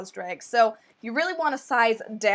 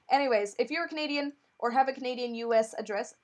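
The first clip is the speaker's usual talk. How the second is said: usual speaking voice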